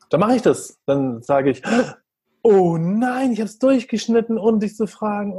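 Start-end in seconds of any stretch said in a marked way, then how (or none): 2.4-5.4 higher, hammed-up oh no; mock dismay. Mother playfully apologizing to her upset son, mock dismay. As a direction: play the dismay big for the child. Parent plays oops to son.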